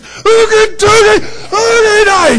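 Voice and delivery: high, loud, slow mocking shout; a sergeant mocking a recruit's okie dokie; mocking drill sergeant. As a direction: throw the words back at him, yelling in his face. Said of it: "he's in my face".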